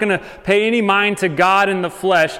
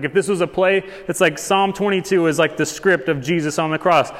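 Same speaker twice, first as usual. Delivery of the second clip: normal talking voice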